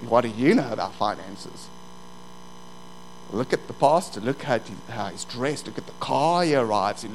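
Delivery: low, needling taunt; devil's voice. Acted. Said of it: "the devil says".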